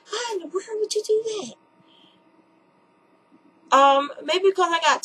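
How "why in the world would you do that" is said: very high, squealing mock outrage; the lady aghast that someone reduced her breasts; shocked disbelief. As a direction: shriek it like you can't believe it.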